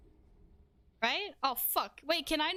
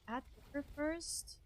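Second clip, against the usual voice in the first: puzzled; 2 semitones higher, 9 dB quieter, slower than usual. Real, not acted. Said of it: "What do you mean add door first?".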